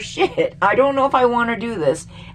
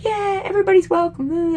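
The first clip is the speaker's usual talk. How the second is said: high, whiny mock of a smug politician; mocking Trudeau; 11 semitones higher than usual. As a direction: parody him with a sing-song high pitch. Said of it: "Trudeau's like".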